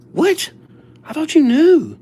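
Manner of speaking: very high, incredulous mock outburst; hammed-up high-pitched astonishment; retold disbelief. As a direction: squeal it in exaggerated disbelief. Retold disbelief at friend's answer.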